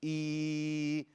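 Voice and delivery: "E" as one long, even vowel; held like med. Shown as a demonstration. Teacher shows the mistaken med, long held vowel. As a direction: hold "E" steady without a push. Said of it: "like you're doing a med".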